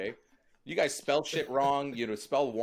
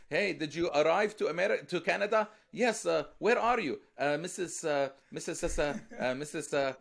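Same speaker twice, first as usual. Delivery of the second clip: higher, halting, stumbling over Mississauga; lightly mimicking a newcomer fumbling the city name; mock immigrant on the phone; 3 semitones higher than usual. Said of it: "what they did when they called back home".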